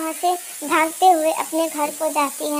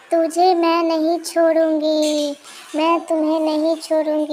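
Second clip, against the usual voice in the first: witch threat. Acted. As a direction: menace her like a ghost.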